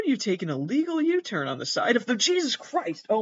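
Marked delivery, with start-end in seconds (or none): 2.2-3.2 much higher, shocked "Jesus Christ"; shocked exclamation. Real, not acted. Watching a U-turn go wrong, high shocked outburst. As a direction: blurt it out as the car crashes. Sudden crash on screen.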